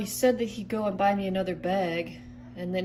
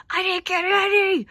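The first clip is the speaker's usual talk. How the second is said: higher, louder angry outburst; mad, escalating; 9 semitones higher, 6 dB louder than usual. Acted. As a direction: yell the complaint at the top of your pitch. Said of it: "I'm so mad at you".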